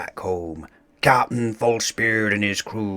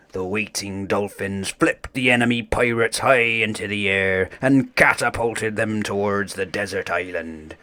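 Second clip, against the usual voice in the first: ordinary delivery, nothing marked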